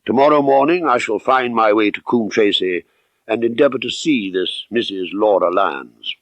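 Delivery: usual speaking voice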